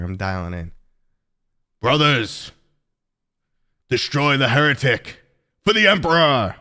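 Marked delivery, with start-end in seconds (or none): 1.8-6.6 loud, far-off Space Marine battle cry; gruff space marine. Acted. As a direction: bellow it like an armored warrior. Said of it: "this should be the Space Marine voice right here".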